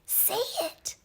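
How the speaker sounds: high, sharp hissed whisper; hissed prompt. Acted. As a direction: hiss it at her urgently. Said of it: "hissed an angel".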